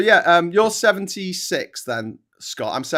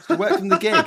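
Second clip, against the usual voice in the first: laughing; 13 semitones higher, 4 dB louder than usual. Real, not acted. Laughing joke after quote.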